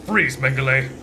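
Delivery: loud, sharp cop command; commanding shout. Acted. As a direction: bark the order to freeze. Detective gets the drop on villain.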